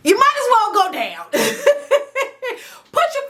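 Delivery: high, laughing through the words; amused giggling advice. Real, not acted. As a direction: crack up mid-sentence. Laughing at her own advice.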